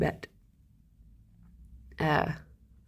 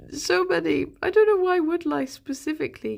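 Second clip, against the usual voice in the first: exclaimed echo; 9 semitones higher than usual. Real, not acted. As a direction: repeat it with surprise. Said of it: "Oh, there's so many".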